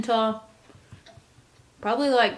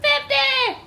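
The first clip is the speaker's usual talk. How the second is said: mock wail; 4 dB louder than usual. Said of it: "I'm gonna be like".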